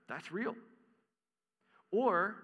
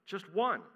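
ONE lifted higher and louder; stress on just one; 5 semitones higher, 5 dB louder than usual. Acted. Preacher to congregation, stressing one lost sheep. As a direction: lean hard on just one.

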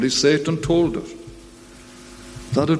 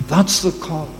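ordinary talk, nothing marked

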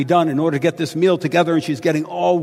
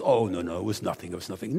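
mock modest murmur; 8 semitones lower, 9 dB quieter, faster than usual. Imagined Martha modestly deflecting.

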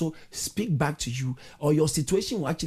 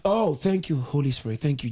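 ordinary talk, nothing marked

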